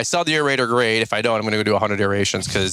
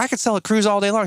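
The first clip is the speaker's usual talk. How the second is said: light imitation of an Alabama accent, pitched higher; Savannah's Alabama accent; 9 semitones higher than usual. Quoting Savannah from a podcast on selling cruises, mild Alabama accent. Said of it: "Alabama accent".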